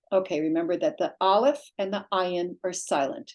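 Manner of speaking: ordinary talk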